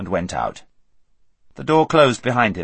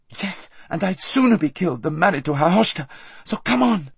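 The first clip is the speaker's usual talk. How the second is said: high, firm, determined; defiant Aravis; 8 semitones higher than usual. A narrator's reading. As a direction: refuse and push on.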